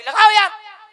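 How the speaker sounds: high, loud protest; defensive retort. Retold or imitated. DJ sound man protesting to the groom's party, loud. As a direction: shout back defensively.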